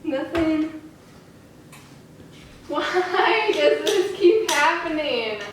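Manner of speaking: high, slow anguished plea; despairing. Real, not acted. A woman seeing yet another negative test, anguished. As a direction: drag it out in despair.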